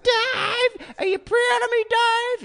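very high sing-song voice; mocking Karen. Imitating Karen's posts to the drummer, very high mock voice. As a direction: squeak it out like a needy fan. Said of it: "It's always like".

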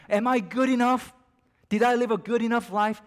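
anxious plea. Acted. A believer cries out, pleading for validation. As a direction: cry out the doubt at full force. Preacher voices the doubt again.

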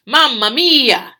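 loud, slow mock Italian accent. Imitating the Italian man's accent, hammed-up and loud. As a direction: stretch it out in a cartoon Italian lilt. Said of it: "That's what he sounds like".

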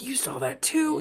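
high, hushed-excited retold question; excited disbelief. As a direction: ask it high, thrilled to share it. Retold excited reply to her whisper.

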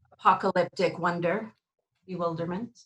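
normal talking voice

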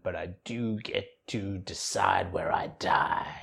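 soft whispered slow delivery; whispered quote. Retold or imitated. Quoting the dying teen's last line, whispered and slow. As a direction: breathe the line like a dying confession.

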